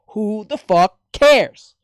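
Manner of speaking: loud, slow, drawn-out outburst; who the fuck cares, shouted slowly; exasperated shout. Real, not acted. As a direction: yell it in disbelief. Ranting about voice casting.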